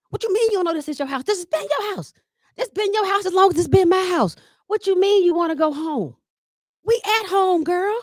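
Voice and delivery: high-pitched exasperated disbelief at mama; frustrated pleading. Retold or imitated. Caregiver son pleading with confused mama, exasperated and high. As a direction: push back hard, voice climbing in disbelief. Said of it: "I get frustrated".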